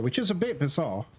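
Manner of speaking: normal talking voice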